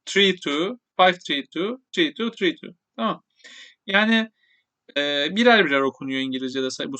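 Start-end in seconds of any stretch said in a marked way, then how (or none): 0.1-2.7 digits one by one. Shown as a demonstration. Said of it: "yanlış okudum".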